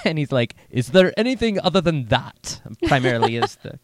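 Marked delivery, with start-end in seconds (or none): 0.7-2.6 higher-pitched mock-British accent; hammed-up British voice, pitched up. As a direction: put on a British accent for a hopeful question. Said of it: "that's how he talked in the British accent".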